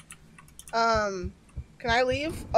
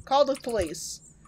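ordinary delivery

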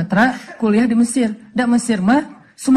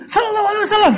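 very high mock angry shout; imitated irate, high-pitched reply; angry salawat; 13 semitones higher, much faster than usual. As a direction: fire back the blessing as if furious. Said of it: "ada juga yang sambil marah".

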